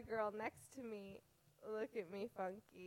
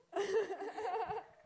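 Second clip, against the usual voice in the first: high burst of laughter; 11 semitones higher, 7 dB louder than usual. Real, not acted.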